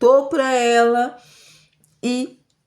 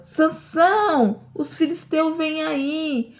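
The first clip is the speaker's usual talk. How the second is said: mock shout; 6 semitones higher, slower than usual. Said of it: "E ela gritava".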